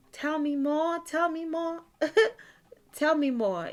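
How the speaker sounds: giggly mock-shy flattered voice; flattered blushing. Shown as a demonstration. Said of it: "I'm trying to dramatize something".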